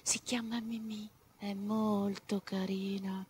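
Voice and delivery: hushed unvoiced whisper; soft storyteller whisper. A narrator's reading. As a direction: whisper it like a secret. Rabbit confides softly.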